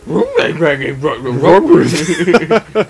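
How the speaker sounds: laughing. Acted. Laughing slow parody.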